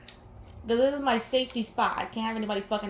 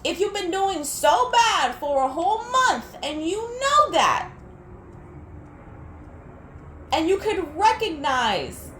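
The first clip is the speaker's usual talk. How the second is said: heated rant; 5 semitones higher, 3 dB louder than usual. Real, not acted. Continuing the angry rant.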